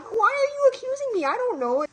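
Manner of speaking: squeaky high protest, mock innocent; high defensive squeal. To her mom, a high defensive squeal. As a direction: play the falsely accused kid, voice jumping way up.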